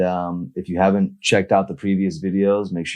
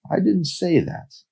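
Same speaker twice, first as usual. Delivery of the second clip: defensive man voice; 13 semitones higher, slower than usual. Acted. Carlos denies, high, slow.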